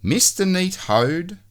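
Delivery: plain reading voice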